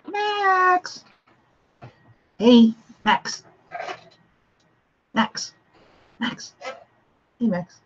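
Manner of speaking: high drawn-out call of a name; high-pitched singsong summons. Real, not acted. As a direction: call to get Max's attention. Calling out to Max.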